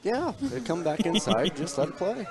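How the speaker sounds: high teasing echo of the line; mocking high voice. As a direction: parrot it back in a high, teasing voice. Mocking partner's rule complaint.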